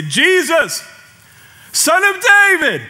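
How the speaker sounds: high, loud plea to Jesus; a blind beggar crying out for mercy. Retold or imitated. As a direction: call out desperately over the crowd. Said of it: "He got loud".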